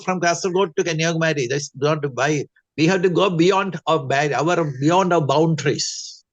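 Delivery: ordinary talk, nothing marked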